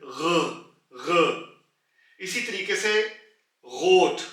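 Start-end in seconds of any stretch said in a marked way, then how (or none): 0.0-2.2 ग rasped deep from the back of the throat; throaty R sound. Shown as a demonstration. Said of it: "back of the throat से निकालनी है". 3.7-4.2 गोट with a rasped throat ग, loud; throaty R word. Shown as a demonstration. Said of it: "back of the throat से निकालनी है".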